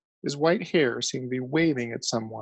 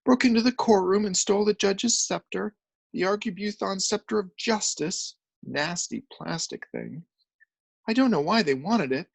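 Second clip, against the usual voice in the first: higher, shaken telling; distraught journalist; 7 semitones higher than usual. A narrator's reading. Journalist shakily telling of the stolen scepter. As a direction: recount it half-crazed.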